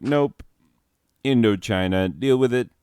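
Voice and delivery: high, loud, curt mock refusal; mock dismissive. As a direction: brush them off, imitating a stubborn ruler. France voiced dismissing the protest.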